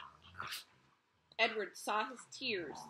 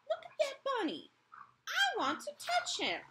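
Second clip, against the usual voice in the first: put-on high, loud eager little kid; small child; 5 dB louder than usual. Small child begging his mother, high and loud. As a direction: beg like a small child who wants to touch. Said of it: "said a small child".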